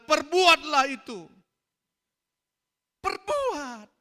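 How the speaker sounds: high, loud preacher's exhortation; preaching at full force. Real, not acted. Preacher exhorting the church at full force to obey. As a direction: command them to obey.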